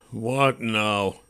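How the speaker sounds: a hint of a weary grumble; grumbling. A narrator's reading. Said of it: "I grumbled".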